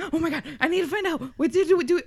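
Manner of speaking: gasp, then high rapid urgency; eager page-turner. Retold or imitated. Co-host imitating a reader gripped by the book, mock urgent. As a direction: rush it out, desperate to find out. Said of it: "definitely one of those, like".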